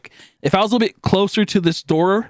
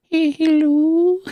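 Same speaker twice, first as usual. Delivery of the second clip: put-on much higher, slow girly hello; little girl voice; 12 semitones higher, much slower than usual. Patient on the phone, slow high girly voice. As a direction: coo it like a tiny old lady. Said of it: "the moment I heard that".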